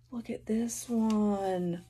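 ordinary talk

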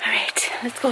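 hushed excited whisper. Real, not acted. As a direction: whisper it eagerly. Arriving quietly at sister's house.

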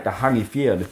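plain reading voice

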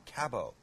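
Cabo said as CAB-oh; flat Canadian A in Cabo, as in "cab"; Canadian pronunciation. Acted. Cook as Canadian repeating the place, Canadian pronunciation of Cabo. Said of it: "as the Canadians would say".

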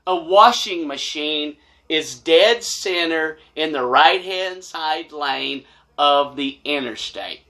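ordinary talk, nothing marked

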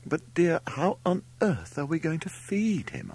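high-pitched motherly dismay; fretting mother. A narrator's reading. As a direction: ask it as a worried mother, voice up high. Narrator voices the dismayed Mother.

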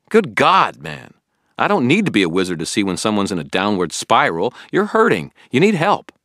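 pleading anger; angry shouting. A narrator's reading. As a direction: shout your worry at him. Billy shouting at Harry.

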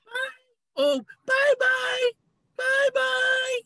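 high shouted bye-bye, stretched slowly; calling out goodbye. Acted. Animal calls goodbye to the departing flyers, high shouted farewell. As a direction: call it up to the sky, drawn out.

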